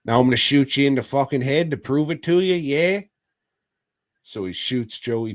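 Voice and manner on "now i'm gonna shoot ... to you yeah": put-on high, loud, rushed "mate" accent; mock doctor, Cockney-style accent. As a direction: crow it in a broad comic Cockney-style accent. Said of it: "And the doctor's like".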